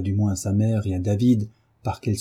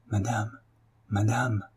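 softer, hushed warning; said very low; 5 dB quieter than usual. A narrator's reading. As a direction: breathe it like fatal news to her mistress. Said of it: "en disant tout bas à sa maîtresse". (la servante annonce tout bas, craintive)

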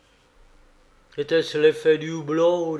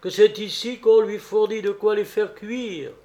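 higher dwarf voice; nain moqueur; 6 semitones higher, 4 dB louder than usual. Acted. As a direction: keep up the high, teasing dwarf voice. Le nain poursuit sa révélation. (voix de nain haut perchée)

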